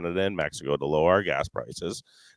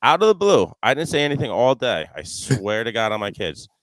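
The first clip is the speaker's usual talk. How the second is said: ordinary delivery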